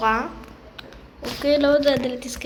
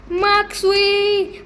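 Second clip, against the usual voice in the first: long, loud, higher call; 8 semitones higher, 7 dB louder, much slower than usual. Real, not acted. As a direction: call his name across the map. Calling out to character. (קריאה ארוכה ורמה)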